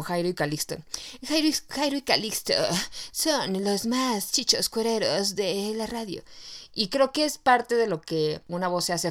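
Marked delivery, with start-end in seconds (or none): 1.3-6.2 mock sensual radio voice. Host imitating a radio announcer, mock sensual. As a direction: purr it like a seductive DJ. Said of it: "toda sensual".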